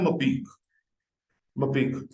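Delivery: teacher says मपीक slowly and clearly, stress on the second syllable; teaching a term. Shown as a demonstration. Teacher to students, clearly sounding out मपीक. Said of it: "जिसको कहते हैं मपीक".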